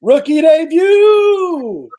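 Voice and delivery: excited shout. Real, not acted. Host hypes the guest's rookie debut with a slow, loud announcer shout. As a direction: hype it up like a stadium announcer.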